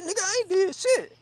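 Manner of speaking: very high protesting squawk; hammed-up high defensiveness; indignant denial. Protests his innocence to friend.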